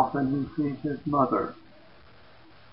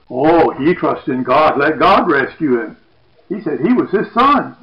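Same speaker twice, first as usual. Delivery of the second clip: mocking; 2 semitones higher, 8 dB louder than usual. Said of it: "people mock Jesus".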